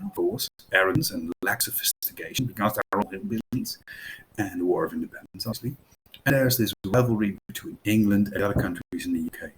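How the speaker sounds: usual speaking voice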